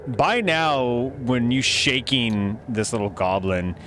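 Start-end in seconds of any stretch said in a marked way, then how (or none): none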